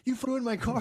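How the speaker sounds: high-pitched mock yell of outrage; imitated screaming. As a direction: imitate an irate car owner yelling. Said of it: "this guy starts screaming at the towing guy".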